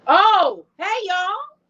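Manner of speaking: high, bright burst of hello; loud relieved hello; excited greeting. Real, not acted. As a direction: greet them like relief just hit. Sound finally works, greeting.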